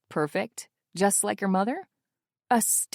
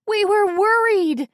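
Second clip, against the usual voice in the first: loud, high, slower yell of worry; June yells; 12 semitones higher, 9 dB louder than usual. Acted. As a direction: shout your worry at her. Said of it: "June yells".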